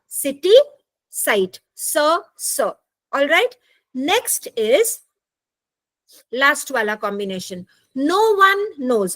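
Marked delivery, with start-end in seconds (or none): 0.1-2.7 सिटी and साइट said slowly, each starting with a clear hissed स; s sound demo. Shown as a demonstration. Teacher shows students that सिटी and साइट start with the same स sound. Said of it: "उच्चारण same अक्षर वाला आपको सुनाई देगा". 8.0-9.2 no one knows said very slowly, one stretched and falling; slow pronunciation demo. Shown as a demonstration. Teacher slowly models the phrase for online class. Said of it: "no one is one word".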